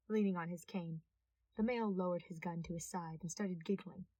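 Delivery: usual speaking voice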